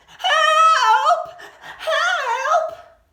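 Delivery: very high, loud cry for help; frightened cry for help. A narrator's reading. Rabbit calls for help, high frightened shout. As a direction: scream for help in terror. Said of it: "called a frightened voice".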